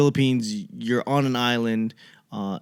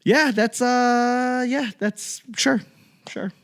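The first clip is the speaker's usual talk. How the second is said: unimpressed; 12 semitones higher than usual. Retold or imitated. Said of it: "I go to Boston and go".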